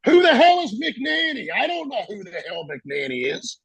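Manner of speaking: high-pitched mock outburst, falling off in volume; imitated angry yell. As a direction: act out an exasperated chief hollering. Retold bellowing of a senior chief.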